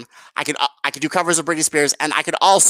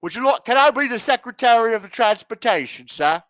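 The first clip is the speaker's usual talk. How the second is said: begging official; 6 semitones higher than usual. Retold or imitated. Mock Ghani begging for post.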